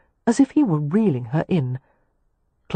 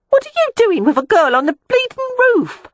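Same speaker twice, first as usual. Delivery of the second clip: very high, loud Cockney street woman, cross; annoyed prostitute; 5 dB louder than usual. A narrator's reading. From the brothel window to the noisy couple, a high, loud annoyed complaint. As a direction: screech it out the window, fed up. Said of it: "The prostitute continued to lean out".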